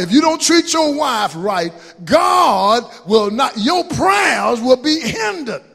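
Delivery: loud, slow, hammering sermon shout; preaching at full force. Acted. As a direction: thunder it from the pulpit.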